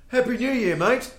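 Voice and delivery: high, loud mock-cheerful shout; oblivious cheery greeting. Clueless friend greeting an angry stranger, oblivious loud cheer. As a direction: holler it high like a clueless reveller.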